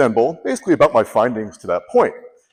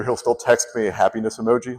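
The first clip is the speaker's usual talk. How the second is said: ordinary talk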